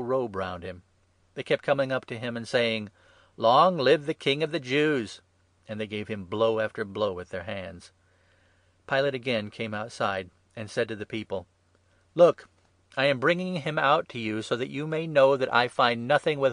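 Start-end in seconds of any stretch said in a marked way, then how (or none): 3.4-5.2 loud, slow, raised acclamation; mocking hail. A narrator's reading. Soldiers hailing their prisoner as king, loud mockery. 12.1-16.5 loud, raised governor's voice; public proclamation. A narrator's reading. Pilate bringing Jesus out to the people, proclaiming.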